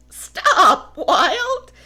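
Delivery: panting, loud and high; breathless shout; out of breath. Acted. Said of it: "panted Woolly".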